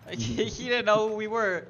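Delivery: laughing while talking; words broken by laughter. Real, not acted. Laughing through the story.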